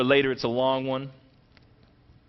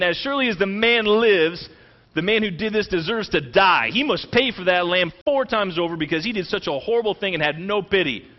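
loud, raised furious verdict; burning anger; 5 semitones higher, 5 dB louder than usual. Retold or imitated. Preacher voicing King David's rage at Nathan's story, furious shout. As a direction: thunder out the death sentence. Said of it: "David burned with anger".

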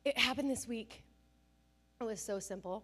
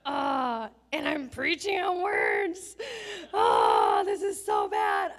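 loud, higher cry of dismay with a sob-like break; embarrassed cry; 4 semitones higher, 11 dB louder, much slower than usual. Real, not acted. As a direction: wail it, caught out and mortified. Realizing her own hypocrisy.

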